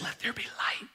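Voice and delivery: hushed, voiceless divine command; whisper. Acted. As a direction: whisper it softly and solemnly. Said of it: "When Jesus whispers and says".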